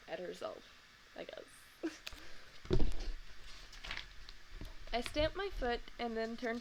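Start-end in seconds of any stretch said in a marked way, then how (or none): 1.8-4.9 real laugh. Real, not acted. Reader laughs at picture.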